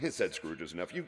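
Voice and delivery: plain reading voice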